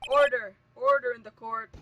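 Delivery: loud, commanding judge's shout; booming acted courtroom command; judge calling order. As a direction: call the noisy courtroom to order.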